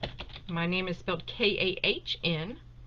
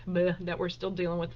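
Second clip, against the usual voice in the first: normal talking voice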